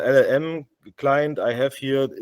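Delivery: ordinary talk, nothing marked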